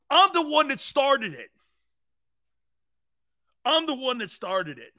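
high, loud indignant rant; outraged. Real, not acted. Host ranting at a co-host who copied his pick, loud and indignant. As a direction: loudly claim credit, wound up.

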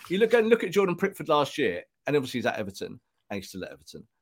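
normal talking voice

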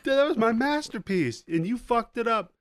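hammed-up villain fury; pissed villain. Villain scolding the kid over his ruined masterpiece, mock furious. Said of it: "Psycho Gorman's pissed".

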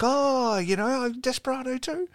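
high, breathy-free squeaky fan voice; imitating a fan. Fan showing her Desperado bear, in a high mock fan voice. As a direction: chirp it like an excited young fan.

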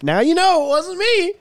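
squeaky mock voice of the actor. Actor mocked by the podcaster, high voice. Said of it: "even he was like".

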